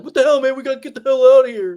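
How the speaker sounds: high, loud, rushed imitation of a coward; panicked coward. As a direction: yelp it fast like a coward desperate to flee. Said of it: "The coward guy. He was like".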